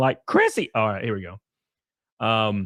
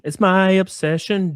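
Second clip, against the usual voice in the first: higher, slow, theatrical proclamation; mock dramatic; 8 semitones higher, 4 dB louder, slower than usual. Streamer hamming up a troll's insult, mock dramatic. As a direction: declare it like a soap-opera reveal.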